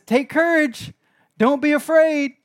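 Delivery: loud, high, insistent preaching; Jesus urging his frightened disciples, preaching loud. Acted. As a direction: plead with the crowd to trust. Jesus calls out in storm.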